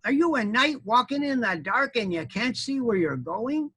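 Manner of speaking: high, drawn-out complaint; hammed-up storybook outrage; yelling, indignant armadillo. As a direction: berate the knight in a high animal voice. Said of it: "it yelled at me".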